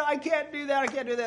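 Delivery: high-pitched repeated protest, imitated; determined refusal. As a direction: retell a stubborn refusal. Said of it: "with such great determination".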